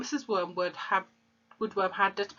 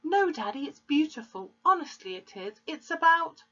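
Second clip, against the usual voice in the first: put-on high girl's earnest protest; pleading girl; 10 semitones higher than usual. A narrator's reading. Daughter protesting to her father, high and earnest. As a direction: insist sweetly, voice up high.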